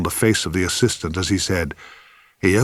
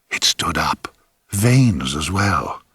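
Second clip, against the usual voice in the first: slow, awed, lower grating whisper; 4 semitones lower, much slower than usual. A narrator's reading. Assistant recounting the miracle to the actor, awed grating whisper. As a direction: rasp it out in awe. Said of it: "with a kind of grating whisper".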